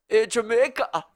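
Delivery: put-on loud, high film-villain German accent; hammed-up German bad guy, on the edge of laughing; bad fake German. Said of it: "American dudes pretend to be Germans".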